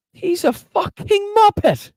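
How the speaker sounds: high, slow squealing insult; mock squeal. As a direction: draw it out in a high mocking squeal. Streamer ranting at Trump clip.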